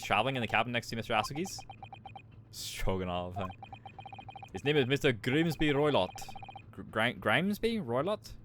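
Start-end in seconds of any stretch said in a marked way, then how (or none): 4.5-6.1 put-on proud guard in a Russian accent. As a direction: boast about first class in a Russian accent.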